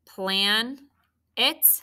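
"Plan it" as two syllables with a pause; split by syllable. Shown as a demonstration. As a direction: say "Plan it" piece by piece, each rising. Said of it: "Did you scoop?".

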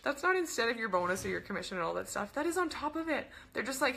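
ordinary delivery

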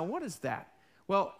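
ordinary talk, nothing marked

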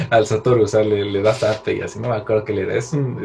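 normal talking voice

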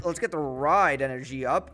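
normal talking voice

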